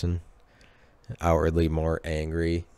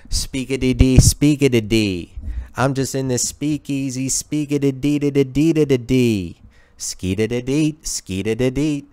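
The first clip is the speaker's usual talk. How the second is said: higher put-on scatting voice; Speakeasy Tom; 5 semitones higher than usual. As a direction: rattle off nonsense syllables in a rhythmic character voice. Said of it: "capable of that exact accent".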